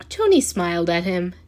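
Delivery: ordinary delivery, nothing marked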